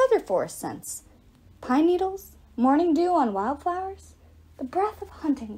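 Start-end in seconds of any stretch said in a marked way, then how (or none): none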